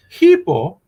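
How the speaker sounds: high, loud, drawn-out mock Greek; mock Greek translator. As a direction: stretch the word out high, playing at Greek. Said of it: "that's my old Greek translations".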